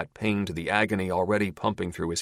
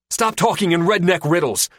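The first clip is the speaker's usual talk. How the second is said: loud, much higher, furious snap; exasperated shout at the captor; angry outburst; 11 semitones higher, 8 dB louder than usual. Acted. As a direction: lose patience and bark it. Linder loses patience.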